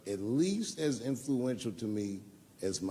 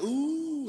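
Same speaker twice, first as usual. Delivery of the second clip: mock crowd ooh; 14 semitones higher, 6 dB louder than usual. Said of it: "The crowd's like".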